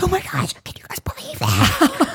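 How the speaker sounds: high squeak sinking to a whisper; hushed, squeaky disbelief; whisper-squeal it to the people around you; retold amazement. Retold amazement on opening her eyes.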